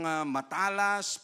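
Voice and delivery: ordinary talk, nothing marked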